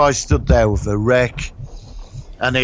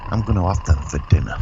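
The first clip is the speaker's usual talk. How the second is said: low, quick sinister plan; low menacing thought; 6 semitones lower, faster than usual. Retold or imitated. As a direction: say it like a villain plotting. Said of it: "he's looking at them thinking".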